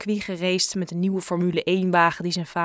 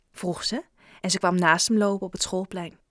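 ordinary delivery, nothing marked

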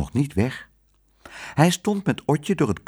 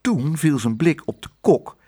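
usual speaking voice

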